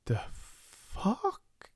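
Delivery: gasping in shock as her father is tackled; gasped. Acted. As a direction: choke it out in disbelief. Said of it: "gasped Bella".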